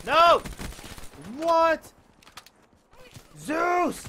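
high, loud cries of disbelief; shocked shouting. Real, not acted. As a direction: yell each word in protest. Sudden setback in the match.

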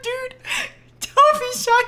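ordinary talk

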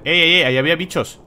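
loud startled yelp; startled shout. Real, not acted. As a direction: jump at the sight and shout. Startled by bugs.

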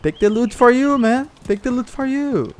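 high, sing-song offer; mock friendly voice. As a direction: pretend to be a nice guy.

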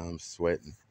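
usual speaking voice

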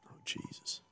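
breathy whispered oh Jesus; whispered dismay. Real, not acted. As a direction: whisper it in disbelief.

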